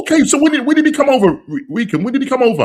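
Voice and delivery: high, loud, insistent questions; amazed questioning. Real, not acted. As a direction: press for the answer in real astonishment. Amazed at viewer's move to England.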